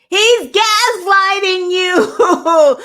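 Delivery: high, slow, loud outcry; outraged shout. Real, not acted. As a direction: cry it out in outrage. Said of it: "outraged shout".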